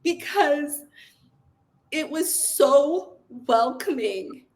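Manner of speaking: real emotion breaking through as she recalls it; choked-up, heartfelt recollection; getting emotional. Real, not acted. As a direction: speak close to tears, fighting to keep going. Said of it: "sorry, I'm gonna get emotional".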